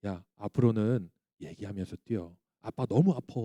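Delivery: low, soft fatherly request; gentle bedtime talk. Retold or imitated. Dad to son at bedtime, soft and gentle. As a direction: quietly reason with a child.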